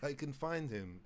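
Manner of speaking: mock-Russian musing; Russian accent. Russian fan looking for the host online, Russian accent. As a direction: think it through in a Russian accent. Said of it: "My, my Russian accent".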